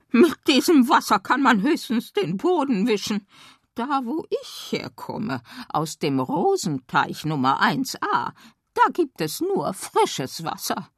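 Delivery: puffed up with offended pride; indignant frog. Acted. Frog complains to the girl about dirty water, high and indignant. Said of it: "nickte entrüstet und stieß hervor".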